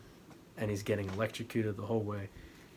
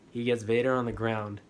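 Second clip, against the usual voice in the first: louder excited repeat; building excitement; 9 dB louder than usual. Real, not acted. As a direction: pump up the retelling.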